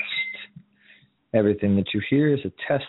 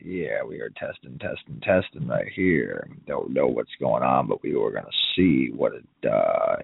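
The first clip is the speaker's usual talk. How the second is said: much lower, laid-back low register; deep mic-test voice; 8 semitones lower than usual. Pretend. Host testing the microphone, in a deep low voice. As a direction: sink your voice right down.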